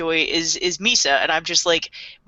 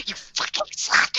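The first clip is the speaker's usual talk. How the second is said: high mock hiss. Viewers react, whispered high.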